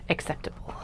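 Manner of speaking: whispered echo to herself; whispering. Real, not acted. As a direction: breathe the word out, unvoiced. Whispered repeat of own word.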